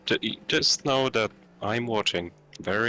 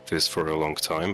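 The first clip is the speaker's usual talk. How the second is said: usual speaking voice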